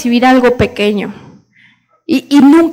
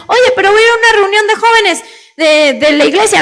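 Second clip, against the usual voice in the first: put-on pleading child; 7 semitones higher, faster than usual. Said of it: "child pleads with father".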